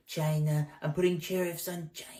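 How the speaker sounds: strained whispered Trump voice; impersonating Trump straining to push; constipated Trump. Retold or imitated. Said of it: "It's like".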